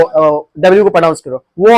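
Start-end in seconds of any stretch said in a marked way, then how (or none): 1.6-1.8 "were" with rounded lips, like "wur"; rounded-mouth pronunciation. Shown as a demonstration. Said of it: "mouth को round करो".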